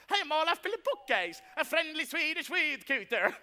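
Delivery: a hint of a fake Swedish lilt in the introduction; bright Swedish woodcutter accent, lightly done; put-on Swedish accent. Goblin passing himself off as a Swedish woodcutter, mild Swedish accent. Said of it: "And what about that accent?".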